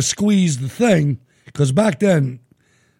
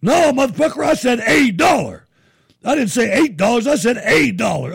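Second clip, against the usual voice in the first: higher, loud retold yell; angry customer yelling; 9 semitones higher, 4 dB louder than usual. Customer yelling at the gas attendant over the price, angry. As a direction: snap at him that you said eight.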